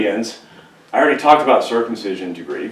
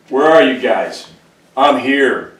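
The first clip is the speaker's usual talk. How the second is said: louder, slow bully's call across the lines; taunting giant; 4 semitones higher, 6 dB louder, slower than usual. Retold or imitated. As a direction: goad them to come fight. Goliath taunting the army.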